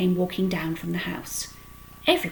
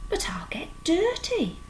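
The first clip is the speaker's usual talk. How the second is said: 1 anxious pig; 10 semitones higher than usual. A narrator's reading. Frightened pig objecting.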